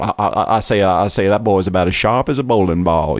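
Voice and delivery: blustering, stammering cartoon rooster; Foghorn Leghorn. Showing cohost the famous cartoon voice, as a blustering rooster impression. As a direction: do a drawling Southern cartoon rooster. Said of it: "a guy like Foghorn Leghorn".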